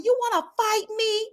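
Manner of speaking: high mock confrontational quote; squaring up, pitched high; imitating a cast member squaring up; mock challenge. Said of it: "standing all up to me".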